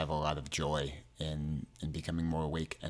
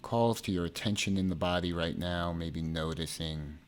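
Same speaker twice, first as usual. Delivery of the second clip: measured meditation delivery, phrases spaced far apart; guided meditation. Acted.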